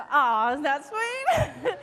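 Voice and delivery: sing-song gushing over something cute; cooing. Acted. Cooing at her own sweet story.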